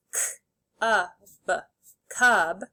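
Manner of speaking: "K, ub" as separate sounds, then "cub" whole; sounded out, blended. Shown as a demonstration. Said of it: "say the sounds and then blend".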